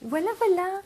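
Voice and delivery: high, lilting voilà voilà; sing-song voilà. Real, not acted. Admires the finished project. (voilà voilà chantonné, aigu)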